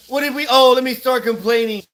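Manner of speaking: ordinary talk, nothing marked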